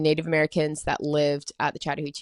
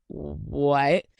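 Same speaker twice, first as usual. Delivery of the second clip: startled what; 4 semitones lower than usual. Retold or imitated. Startled when Jack stops walking.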